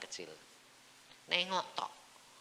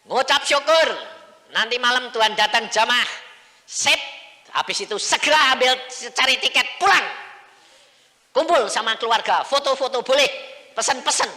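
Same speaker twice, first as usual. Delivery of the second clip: high, loud shout of good news; jubilant announcement; 10 semitones higher, 14 dB louder than usual. Acted. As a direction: call out rapid-fire orders at full volume. Kabar gembira diserukan lewat telepon. (seruan kabar gembira yang keras)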